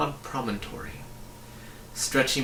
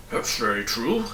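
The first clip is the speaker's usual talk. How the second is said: thought aloud. A narrator's reading. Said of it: "thought Tock".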